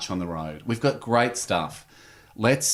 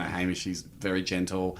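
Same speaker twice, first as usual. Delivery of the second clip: mock sly aside; 5 semitones lower, 8 dB quieter than usual. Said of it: "Andy's like".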